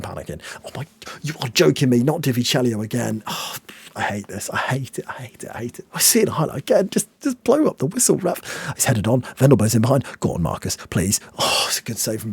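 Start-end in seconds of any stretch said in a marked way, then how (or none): 4.0-5.9 fading from soft to a bare whisper. Real, not acted. Nervous fan fading to a whisper as the clock runs. 6.9-8.4 high pleading. Real, not acted. Begging ref.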